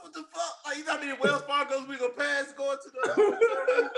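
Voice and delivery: high, incredulous, laughing through it; laughing outrage. Retold or imitated. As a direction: blurt it out in amused disbelief. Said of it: "I said".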